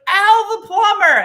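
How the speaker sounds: high, loud proud shout; shouting from audience. Retold or imitated. Audience guy jumping up, loud shout. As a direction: call the name out across the room. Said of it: "he was like".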